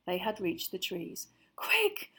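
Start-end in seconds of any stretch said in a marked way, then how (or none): 1.5-2.1 high, urgent moan; anxious moan. A narrator's reading. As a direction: plead for speed, scared. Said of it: "Hermione moaned".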